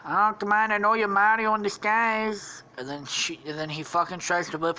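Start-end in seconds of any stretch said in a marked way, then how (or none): 0.0-2.6 raised, loud put-on voice; Luigi. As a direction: protest as a cartoon character. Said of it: "then he's like".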